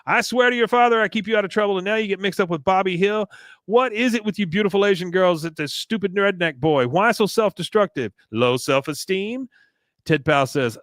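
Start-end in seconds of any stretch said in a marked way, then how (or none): 0.1-9.5 imitating Kahn. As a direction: rant at her like a fed-up father. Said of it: "He says".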